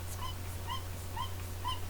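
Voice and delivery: tiny squeaky mouse squeak, very high and soft. Acted.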